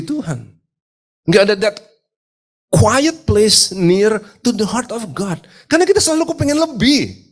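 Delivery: high, urgent sermon voice; impassioned preaching. Real, not acted. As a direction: preach it hard, pitched well up, stretching key words. Preaches with rising force. (khotbah berapi-api dengan nada tinggi)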